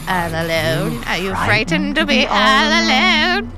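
slow, drawn-out creepy whisper of a stalker. Acted. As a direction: menace the victim, slow and creepy. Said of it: "a very creepy voice that says".

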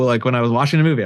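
ordinary talk

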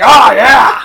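loud, high wrestling-promo yell; loud wrestling-promo "Oh, yeah!"; Vince McMahon strut. Retold or imitated. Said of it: "Like Vince McMahon".

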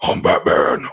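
deep gravelly Batman growl; Keaton Batman voice. Retold or imitated. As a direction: drop way low for Batman. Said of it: "it's a little tough to hear".

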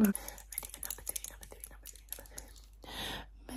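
very soft ASMR whisper; repetitive whisper. Pretend. Doctor readying patient for next test, whispered. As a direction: whisper it over and over, fading.

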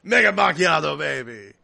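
loud hammed-up swagger, falling on baby; Italian Spider-Man. Hero orders his coffee, loud macho swagger. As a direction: belt the order, then drop off coolly. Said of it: "And he goes".

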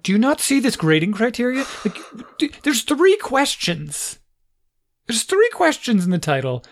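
high-pitched incredulous parent; retold exasperation, pitch jumping up on the key point; exasperated. Parent going over a child's half-done assignment, exasperated. Acting out exasperation at son's work.

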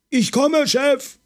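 high, loud call from far off; shouted reply. A narrator's reading. Lucas, running outside through puddles, shouts back to his boss. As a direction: yell back while running.

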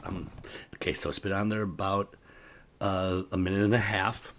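normal talking voice

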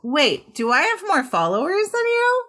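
high, incredulous question; sudden realization, pitch jumps up; surprised. Real, not acted. Surprised at follower count.

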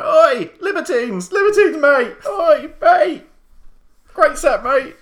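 high, loud mock yell; imagined shout. Restraining urge to shout at a celebrity, loud high yell. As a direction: bellow the band name across the bar. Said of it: "wanted to turn around and shout".

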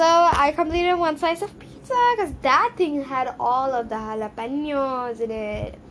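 ordinary delivery

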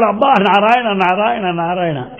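a cry of pain that fades out over the repeats; branded man crying out, then fading; retold shout. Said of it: "that fellow shouted".

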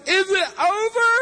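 high, loud tearful whine; mock crying. Retelling a dumped boy asking his girlfriend, mock crying. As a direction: plead in a hammed-up sobbing squeal. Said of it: "he's crying, he's weeping".

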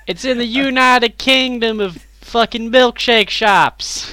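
high, slow American drawl; American accent. Acted. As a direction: drag it out in a mock American twang. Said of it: "talking in a localized American accent".

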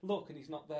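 ordinary delivery

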